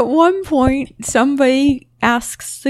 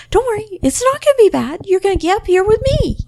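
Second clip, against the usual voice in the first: reassuring; 9 semitones higher, faster than usual. Retold or imitated. Reassuring cosplayers on stage.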